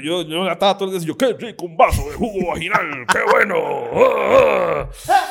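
hammed-up straight-guy bravado; mock macho act. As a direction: overplay the macho front. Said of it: "Gran actor".

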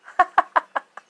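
high, loud burst of laughter; short high-pitched cackling laugh. Real, not acted. Laughs after recalling dirty looks.

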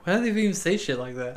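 high, laughing rant about Boston. Real, not acted. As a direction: laugh through the complaint.

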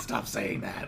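low imitation of exasperation, laugh underneath; Jessica. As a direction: lightly mimic someone sick of hearing it. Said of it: "She's like".

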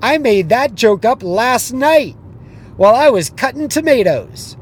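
high, loud, teasing call-out; taunting comedian. Retold or imitated. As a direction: rub it in with a grin. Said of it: "he goes".

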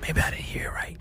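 whispered, doubting herself. Retold or imitated. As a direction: breathe it as a secret worry. Doubting herself in her head.

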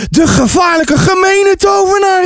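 put-on high, dramatic child's alarm; crying out. A narrator's reading. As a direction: cry out like an alarmed boy. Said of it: "riep Ties uit".

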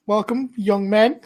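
high, loud welcoming barmaid. Acted. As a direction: welcome them warmly in. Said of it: "She goes".

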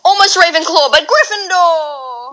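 drawn-out proclaiming voice; hesitate, then proclaim Gryffindor; Sorting Hat verdict. Pretend. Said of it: "can you do the Sorting Hat?".

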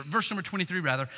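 usual speaking voice

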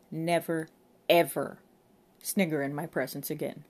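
stern rebuke, "ever" hit hard and loud; commanding, no-nonsense warning; stern authority. A narrator's reading. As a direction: scold the constable like a superior. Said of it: "with the voice of stern authority".

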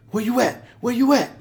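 quick pumped-up where you at; urgent eager call. Retold or imitated. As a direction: fire it off like a friend ready to roll out. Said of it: "he can hear it in my voice".

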